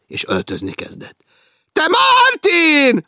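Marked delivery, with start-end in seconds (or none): 1.7-3.1 much higher, loud and slow nagging; shrill teasing woman. Acted. Woman below teasing Martin, shrill and slow. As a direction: drag it out like a shrill landlady.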